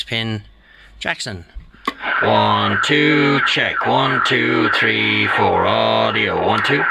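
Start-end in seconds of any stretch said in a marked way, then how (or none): 2.2-6.8 loud, clipped counting into the mic; mic check. Real, not acted. Testing the fixed Jackson mic with a loud count. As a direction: call the numbers out to test the level.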